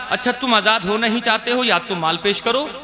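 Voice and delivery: ordinary talk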